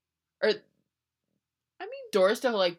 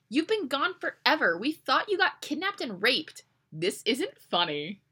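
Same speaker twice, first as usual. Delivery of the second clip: roommate scolding; 7 semitones higher than usual. Acted. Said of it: "she yells".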